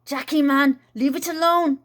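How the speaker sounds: loud yell to her brother. Acted. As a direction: shout across the garden. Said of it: "she yelled".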